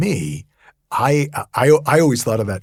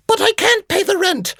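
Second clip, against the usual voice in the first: very high falsetto plea; distressed damsel; 8 dB louder than usual. Retold or imitated. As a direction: squeal it like a helpless heroine. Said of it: "old melodrama played out".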